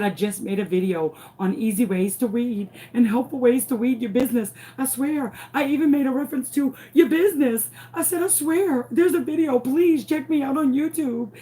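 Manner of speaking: increasingly high, urgent insistence; frantic. Retold or imitated. Mother trying to get police to believe her, frantic. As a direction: pitch climbing steadily into frantic pleading. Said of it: "frantic trying to get these people to believe me".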